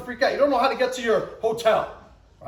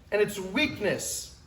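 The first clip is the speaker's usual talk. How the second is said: ordinary delivery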